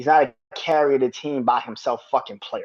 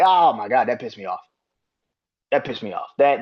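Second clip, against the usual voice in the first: normal talking voice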